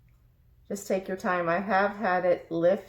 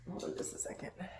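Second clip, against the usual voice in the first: quick whispered aside; 14 dB quieter, much faster than usual. Real, not acted. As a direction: whisper it under your breath. Whispered aside while adjusting turner.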